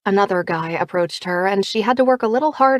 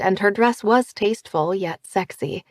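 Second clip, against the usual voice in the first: plain reading voice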